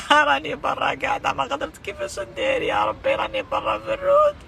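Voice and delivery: sobbing, breaking into high wails; desperate tearful pleading; angry crying. Real, not acted. Woman pleading for injured brother.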